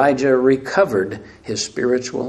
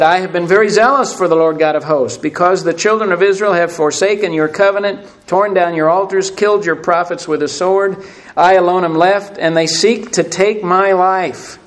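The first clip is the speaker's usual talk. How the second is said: louder, higher declaimed complaint; dramatic scripture reading; 5 semitones higher, 6 dB louder than usual. Real, not acted. Elijah answering God's question, declaimed with force. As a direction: press through the list, slowing at the end.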